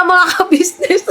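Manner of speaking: laughing through the words, slowly; drawn-out giggle while addressing viewers. Real, not acted. Telling viewers about the rival, laughing and slow.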